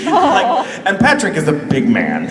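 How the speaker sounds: usual speaking voice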